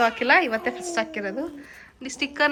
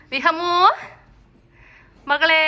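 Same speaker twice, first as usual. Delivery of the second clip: calling out; 6 semitones higher, 10 dB louder, slower than usual. Real, not acted. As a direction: call the daughter by name. Calling baby daughter.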